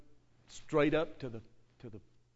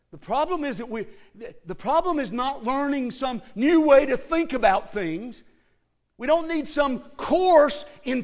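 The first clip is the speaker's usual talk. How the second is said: fiery preaching, building to a shout; 10 semitones higher, 18 dB louder than usual. Real, not acted. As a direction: thunder it from the pulpit. Preaching at full force.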